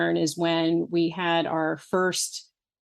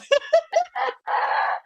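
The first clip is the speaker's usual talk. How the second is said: snorting laughs. Real, not acted. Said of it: "that was a snort".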